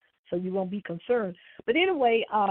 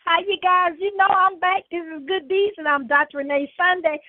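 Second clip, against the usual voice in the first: higher, louder, beaming welcome back; excited greeting; 7 semitones higher, 5 dB louder than usual. Real, not acted. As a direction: greet listeners with big energy. Host returns from the break.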